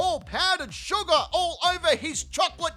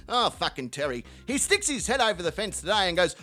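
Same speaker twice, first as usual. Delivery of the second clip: normal talking voice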